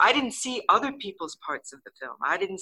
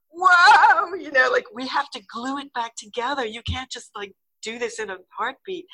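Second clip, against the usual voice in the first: loud high "whoa" easing into pitched-up protest; freaking out; 7 semitones higher, 6 dB louder than usual. Retold or imitated. Art department protesting over the broken cocoon, mock freak-out. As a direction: imitate a crew in mock panic. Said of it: "the art department starts freaking out".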